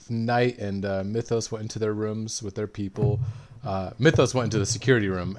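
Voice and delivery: ordinary delivery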